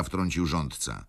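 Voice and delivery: usual speaking voice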